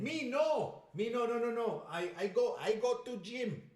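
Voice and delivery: loud, high English with a Latin accent; hammed-up Latin accent on English words. Shown as a demonstration. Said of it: "trying to sound more Latin ... a Latin accent on an English word".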